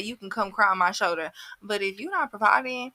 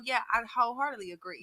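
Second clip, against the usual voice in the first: ordinary talk, nothing marked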